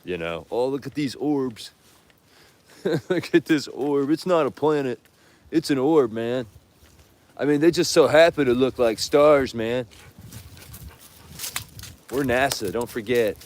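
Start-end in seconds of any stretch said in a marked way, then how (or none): 0.5-9.8 hippie from Wisconsin. Retold or imitated. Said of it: "impression of a hippie from Wisconsin". 12.1-13.4 mock NASA voice. Mocking NASA claim.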